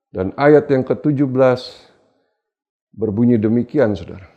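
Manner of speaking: usual speaking voice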